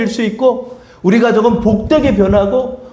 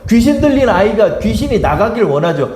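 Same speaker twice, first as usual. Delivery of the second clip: ordinary talk, nothing marked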